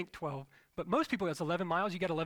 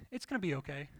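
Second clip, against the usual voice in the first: reassuring. Retold or imitated. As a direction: soothe it gently, imitating her. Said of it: "she's the type of person that's always like".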